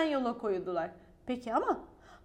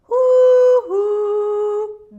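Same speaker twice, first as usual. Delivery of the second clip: high, loud owl call; hoo-hoo like an owl; owl hoot; 7 semitones higher, 15 dB louder than usual. A narrator's reading. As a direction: hoot out to the chick. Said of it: "diye seslendi Tuti".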